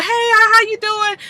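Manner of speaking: put-on high girly voice for the other woman; Chanel's greeting. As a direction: greet them high and sweet. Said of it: "Okay, Chanel".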